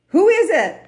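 high, loud, startled call; a panicked man shouting up to the sky; David, hanging from the branch, calls up to the voice; startled question. Retold or imitated. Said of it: "And David says".

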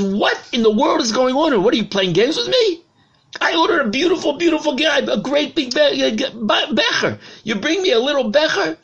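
high, loud, indignant royal outrage; the king explodes at the goldsmith, sputtering; angry king's outburst. Acted. Angry king shouting at the goldsmith over the tiny goblet.

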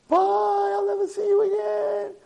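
slow, very high, loud mock wail; wailed farewell. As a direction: cry out a hammed-up last goodbye. Brother wails a farewell.

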